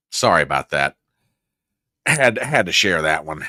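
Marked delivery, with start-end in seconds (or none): none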